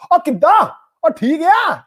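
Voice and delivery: high, loud, brash Punjabi greeting; punjabi accent. Shown as a demonstration. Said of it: "So it's more like".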